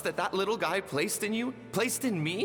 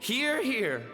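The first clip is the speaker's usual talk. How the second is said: sarcastic cheer; 8 semitones higher than usual. Acted. Bitter mock applause for chief.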